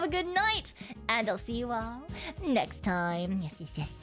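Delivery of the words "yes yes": ordinary talk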